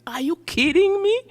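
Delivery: high, loud disbelieving exclamation; incredulous. Real, not acted. Speaker rejecting the claim she keeps her accent on purpose, loud incredulous retort. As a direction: throw it back at them, astonished.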